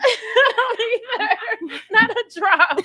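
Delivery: high, loud, drawn-out laughing voice; cracking up while talking. Real, not acted. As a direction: force the words out through laughter. Laughing over having no more wine.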